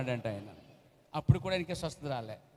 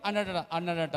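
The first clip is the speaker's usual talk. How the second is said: ordinary talk, nothing marked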